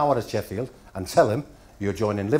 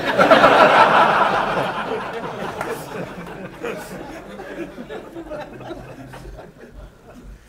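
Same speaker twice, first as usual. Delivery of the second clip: laughter; 5 dB louder than usual. Real, not acted. Audience laughter after punchline.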